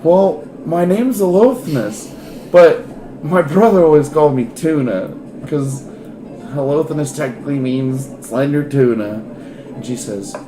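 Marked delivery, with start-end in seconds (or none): 0.0-9.2 Tuna, eager. Acted. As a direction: introduce himself with bashful pride.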